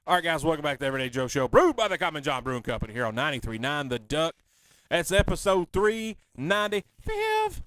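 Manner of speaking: loud, fast station ID like a radio host; radio announcer read. Acted. Host reads the sponsor tag to radio listeners, announcer-style. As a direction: punch it out like a station promo.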